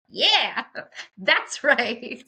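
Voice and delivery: high, loud, laughing "yeah, that's right"; laughing agreement. Retold or imitated. Agreeing with the nurse about the doctor, laughing loudly. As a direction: cheer it through a laugh.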